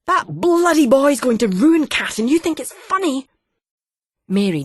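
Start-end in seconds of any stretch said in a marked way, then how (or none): 0.0-3.3 put-on father shouting that the boy will ruin his daughter; outraged. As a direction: roar it at her, outraged.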